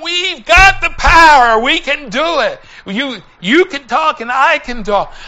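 loud, high rallying cry; rally a reluctant partner like a preacher; retold pep talk at preaching force; rousing conviction. Rallies reluctant companion to preach.

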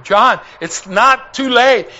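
retold urgent pleading. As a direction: plead earnestly, drawing the words out. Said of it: "I pled with him".

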